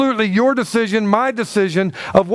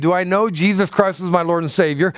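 ordinary talk, nothing marked

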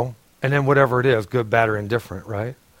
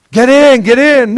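high, loud hurried call to get in; urgent rescuer call; 9 semitones higher, 6 dB louder than usual. Acted. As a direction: shout it up to the roof. Second boat rescuer urging.

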